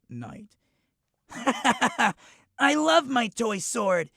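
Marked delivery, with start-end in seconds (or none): 1.3-4.1 put-on higher, louder, playful boy; a kid swinging a toy sword; excited kid voice. Child character plays with sword.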